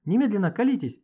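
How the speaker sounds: high, loud demand. Acted. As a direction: order them to confess at once. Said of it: "потребовала она".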